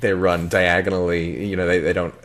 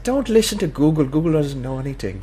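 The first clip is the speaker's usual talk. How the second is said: higher imitated Irish brogue; Irish tow man; 9 semitones higher than usual. Tow man mocking Google Maps in an Irish brogue. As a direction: scold like the local mechanic.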